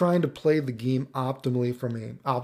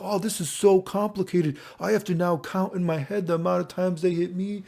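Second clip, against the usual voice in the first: higher-pitched mock overwhelmed player; hammed-up complaint in a raised voice; imagined complaining player; 5 semitones higher than usual. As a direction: act out a player griping about complexity. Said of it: "someone's gonna be like".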